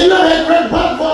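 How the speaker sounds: a hint of a shocked friend; incredulous buddy. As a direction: fire it off in disbelief.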